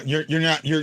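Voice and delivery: ordinary delivery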